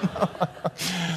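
short real laugh after the joke; breathy laugh from the preacher. Real, not acted. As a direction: laugh at your own mockery. Laughs at own mockery.

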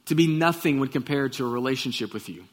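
reverent pastor's prayer. Real, not acted. Opening prayer.

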